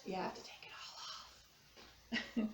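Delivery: whispered conspiratorial aside; playful whisper. Shown as a demonstration. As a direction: whisper it like a silly secret. Playful aside before laughing.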